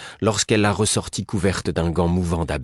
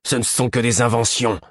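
exclaiming; 5 semitones higher than usual. A narrator's reading. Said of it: "tout en s'exclamant".

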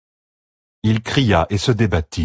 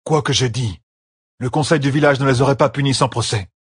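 usual speaking voice

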